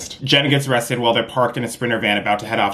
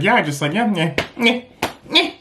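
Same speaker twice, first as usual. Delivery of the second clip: vacant imitation; 9 semitones higher than usual. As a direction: mimic a vacant non-answer. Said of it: "She's like".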